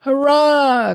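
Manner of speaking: high, loud cheer; joyful cry. Acted. Prince calling from bed, loud joyful cheer. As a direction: burst out with a triumphant hurrah. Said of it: "cried the prince from the bed".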